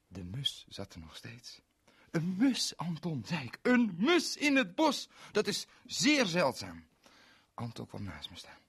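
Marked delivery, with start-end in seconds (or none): 2.1-6.9 high, loud, slow marvelling; gasping at a rare sight; amazed excitement. Acted. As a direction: wonderstruck cry. Excited about sparrow.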